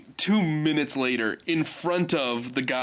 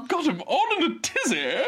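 laughing mock quote. Mocking the line with a laugh.

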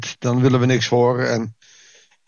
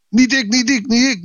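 much higher, insistent refusal; mock protest; 9 semitones higher than usual. Believer refusing God's call, a hammed-up high protest. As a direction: push the call away, pitched up high. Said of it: "onze weerstand opbreken en zeggen".

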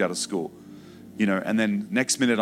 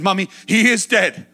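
high, loud insistence; pleading shout; 10 semitones higher, 7 dB louder than usual. Retold or imitated. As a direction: make her believe it. Boy insists to mother.

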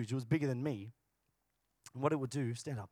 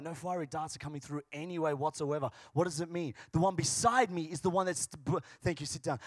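ordinary delivery

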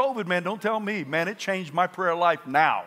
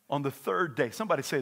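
ordinary talk, nothing marked

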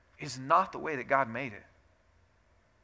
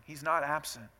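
usual speaking voice

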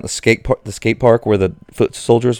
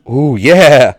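excited; 5 semitones higher, 8 dB louder than usual. Real, not acted. Reacts to the dance-off.

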